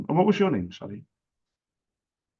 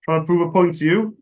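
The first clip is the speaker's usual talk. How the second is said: louder, higher repeated question; emphatic repeat; 6 semitones higher, 7 dB louder than usual. Real, not acted. Asking the partner once more after no answer, emphatic. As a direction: push the question out louder, word by word.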